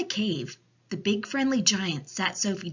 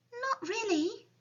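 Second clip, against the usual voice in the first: put-on high-pitched little girl voice; small, high child's reply; Sophie. A narrator's reading. Sophie answers the giant.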